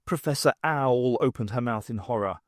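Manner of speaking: usual speaking voice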